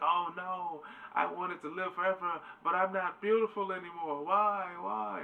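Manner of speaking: high, hammed-up wail of dismay; Lucy freaking out. Lucy seeing her burnt face in the selfie, mock distraught wail. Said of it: "she's freaking out".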